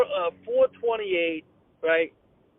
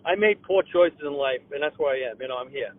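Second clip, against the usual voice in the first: lower, sinking mumble of a broke customer; mock dejected loser; 8 semitones lower than usual. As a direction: mope it out like you gave up on life. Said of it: "another broke-ass person like yourself in there going".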